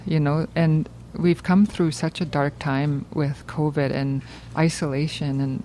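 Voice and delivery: ordinary talk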